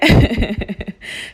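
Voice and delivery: loud burst of giggles; real tickled laughter; amused laugh. Real, not acted. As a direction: laugh out loud at your own mishap. Laughs at her echoing voice.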